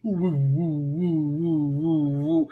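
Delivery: slow drawn-out woo noises; playful sound effect; mock whoosh sounds. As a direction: mimic something tumbling through the air. Mimicking the mannequin flipping.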